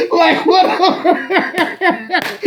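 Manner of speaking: high laughter, words stretched through it; laughing hard. Real, not acted. Laughing at Will reenacting movie. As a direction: crack up mid-word. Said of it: "laughing hard".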